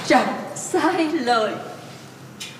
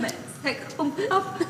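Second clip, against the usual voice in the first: much softer, choked promise; holding back tears; 3 semitones higher, 10 dB quieter, faster than usual. Acted. Mother quietly promises her son she won't cry, holding back tears. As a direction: barely get the words out.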